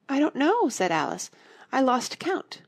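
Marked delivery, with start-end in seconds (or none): 0.1-0.7 flustered. A narrator's reading. Alice lost in the counting. 1.7-2.6 flustered. A narrator's reading. Alice admits she lost count.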